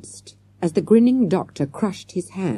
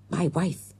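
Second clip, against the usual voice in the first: a softer, hushed aside; whispered; 6 dB quieter than usual. A narrator's reading. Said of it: "he whispered".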